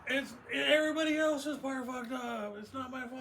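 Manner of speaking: mock yelling. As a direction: whine your innocence. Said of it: "Were you yelling to the people".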